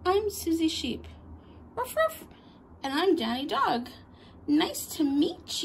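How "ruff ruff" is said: high, playful mock barking; dog bark. Danny Dog barks hello to new friends, high playful yap. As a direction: yap like a little toy dog.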